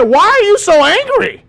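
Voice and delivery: high, loud, drawn-out question; mock indignant shout. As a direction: holler it slowly, imitating the passenger. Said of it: "this motherfucker said".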